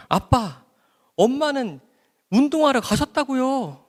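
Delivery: high, slow, fed-up telling off; exasperated scolding. Retold or imitated. Daughter snapping at her father, high and slow. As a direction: spell it out slowly to a parent who won't listen. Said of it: "구박하고 화내기 시작했어요".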